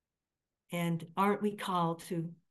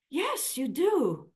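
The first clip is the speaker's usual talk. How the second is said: slow reassuring yes; 8 semitones higher, 4 dB louder, much slower than usual. Real, not acted. Reassuring mom.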